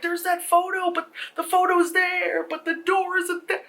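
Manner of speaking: high, insistent panicked voice; freaking out. Acted. Girls comparing the tape with the changed house, freaking out. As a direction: plead it way up high in alarm. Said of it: "the girls are freaking out".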